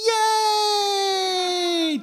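long high joyful cheer. Retold or imitated. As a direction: stretch one delighted cheer way up high. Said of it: "cheering for them".

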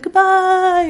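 high, sustained sing-out; held high like a hammed-up farewell; mock farewell. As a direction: call out a drawn-out goodbye. Acted last farewell before catastrophe.

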